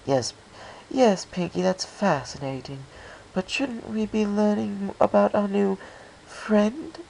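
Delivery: smooth over a shy friend, a touch genteel; lightly voiced Rarity. Rarity brushing off Pinkie.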